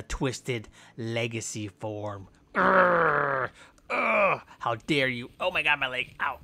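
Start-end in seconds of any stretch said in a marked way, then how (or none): 2.5-4.4 effort grunts. Acted. Attack grunt.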